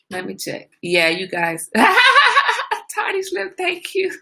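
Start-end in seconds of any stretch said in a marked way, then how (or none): 1.7-2.9 a sudden, high burst of laughter; real laugh. Real, not acted. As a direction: laugh out loud at a viewer's comment. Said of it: "I'm laughing at you".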